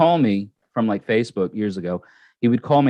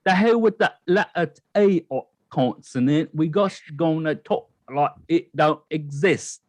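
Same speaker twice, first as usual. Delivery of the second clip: put-on Cockney accent; 5 semitones higher than usual. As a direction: talk like the letter T doesn't exist, Cockney style. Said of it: "Cockney accent".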